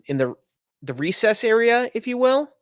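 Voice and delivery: usual speaking voice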